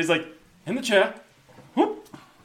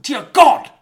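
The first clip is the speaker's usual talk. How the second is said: mock scream; 11 semitones higher, 14 dB louder than usual. Quoted cry inside monologue.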